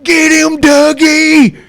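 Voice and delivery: high, loud cheering yell; parents yelling. Retold or imitated. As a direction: egg him on from the street. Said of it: "Dougie's parents would be like".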